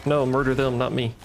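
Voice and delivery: normal talking voice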